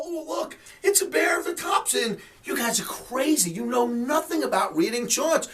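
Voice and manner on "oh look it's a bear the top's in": high sarcastic mock alarm; mocking imitation. Host mocks traders misreading charts, high sarcastic mimicry. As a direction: parody a clueless chart reader, pitched up. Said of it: "and go".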